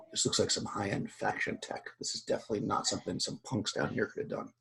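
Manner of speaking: whispered, breath held after a gasp; hushed and shaken. Acted. Fatma kneeling by the body, whispering after a gasp. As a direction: whisper it as if unnerved. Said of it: "she kinda gasps".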